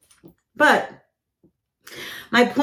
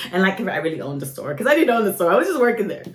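usual speaking voice

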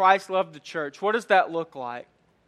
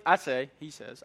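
ordinary delivery

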